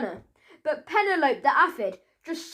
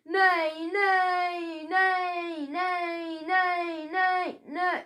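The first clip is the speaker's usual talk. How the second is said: high, whinnying run of neighs; whinny like a pretend centaur; horse neighing; 4 semitones higher, much slower than usual. A narrator's reading. Billy playing centaur as Polly runs, neighing high. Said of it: "not actually a real centaur".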